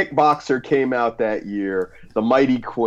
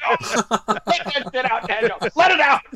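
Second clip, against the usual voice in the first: excited cheering; 12 semitones higher, faster than usual. Retold or imitated. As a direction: cheer him on, laughing. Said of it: "I was like".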